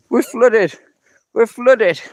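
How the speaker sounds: loud, high surprised cry; surprised exclamation. Real, not acted. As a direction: blurt it out in shock. Exclaiming at flooded path.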